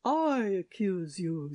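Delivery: slow, heavy accusation; accusing king. A narrator's reading. King accusing the shepherd, slow and heavy. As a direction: lay the blame on him. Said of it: "said the king".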